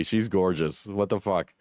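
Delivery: normal talking voice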